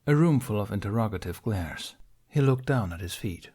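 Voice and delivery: ordinary delivery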